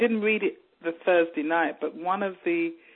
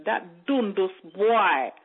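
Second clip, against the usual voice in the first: drawn-out, slightly raised imitation of a woman; woman on the bus; 3 semitones higher, 4 dB louder, slower than usual. Woman on the bus about a boy, imitated drawl. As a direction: stretch it out as the overheard woman. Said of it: "this woman said".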